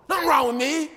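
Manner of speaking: loud, high mock-angry retort; snapping denial. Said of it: "people look at you and say".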